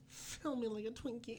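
very high, soft imitation of her; small, high mock-sultry voice; mock dirty talk. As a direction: squeak it softly in a mock bedroom voice.

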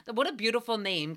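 normal talking voice

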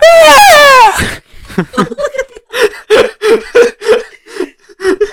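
loud high whale call; whale noise. Pretend. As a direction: howl it out like a whale. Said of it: "[name] just corrupted some eardrums".

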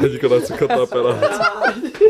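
slow, laughing through the words; can barely get it out for laughing. Real, not acted. Mate begs for the bit to be cut, laughing.

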